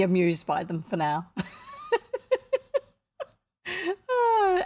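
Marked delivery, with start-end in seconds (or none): 1.3-2.8 very high wheezing giggle; real breathless fit of giggles; helpless laughing. Real, not acted. Reader amused by the captions, high helpless giggling. 4.1-4.6 high, loud sigh as laughter subsides; sigh after laughing. Real, not acted. Reader recovering from the captions, sighing out laughter.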